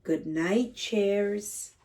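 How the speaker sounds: usual speaking voice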